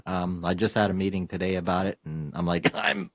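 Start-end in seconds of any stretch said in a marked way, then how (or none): 2.8-3.1 high squealing glee, laughing; excited. Retold or imitated. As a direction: gush it out, laughing with delight. Gleeful retold eager acceptance.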